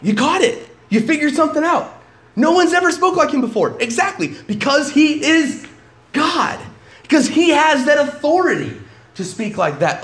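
high, loud emphatic preaching; preacher hammering the point, high and loud; preaching at full force. Acted. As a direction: drive the point home to the congregation.

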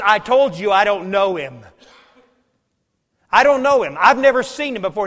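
loud, insistent denial; angry denial. Acted. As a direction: shove the accusation away, loud and hard. Peter denying a second time.

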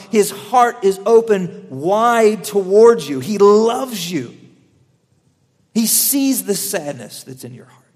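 slow, earnest sermon; tender preaching. Acted. Preacher assuring the congregation of Jesus's love, slow and tender. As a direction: lean in slowly and reassure.

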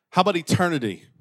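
high, loud revival preaching; fervent preaching. Real, not acted. Preacher rousing church about the party in heaven, fervent. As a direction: build a rousing vision and keep it driving.

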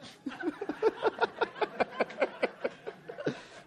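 long breathless high laugh; real helpless laughter. Real, not acted. Congregation and preacher laughing.

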